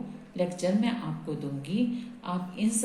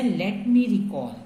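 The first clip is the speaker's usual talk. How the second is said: slow dictation; 6 dB louder, much slower than usual. Shown as a demonstration. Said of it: "next sentence है".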